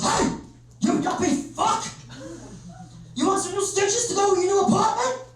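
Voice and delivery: high, strained American tough-guy voice; angry biker. Acted. Biker grabbing the man who nudged his motorbike, mock menacing. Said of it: "the guy grabs me and just goes".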